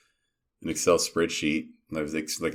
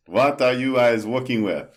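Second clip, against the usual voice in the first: louder, higher with an Israeli accent; 7 semitones higher, 7 dB louder than usual. Shown as a demonstration. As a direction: push a heavy Israeli accent onto it. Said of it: "Do I have to say it with more of an Israeli accent?".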